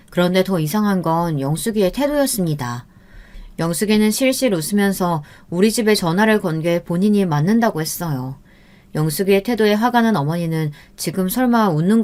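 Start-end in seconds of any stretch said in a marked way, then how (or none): none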